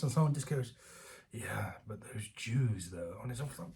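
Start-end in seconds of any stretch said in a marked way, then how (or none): 1.3-3.2 slow, softer creepy aside; sinister crank voice. Retold or imitated. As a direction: lean in like a conspiracy nut. Voicing a conspiracy crank.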